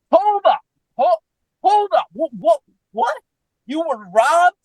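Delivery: very high, halting double takes; high-pitched, incredulous questioning; stunned disbelief. Retold or imitated. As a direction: imitate a flabbergasted woman stopping everyone. Quotes Lauren's stunned disbelief.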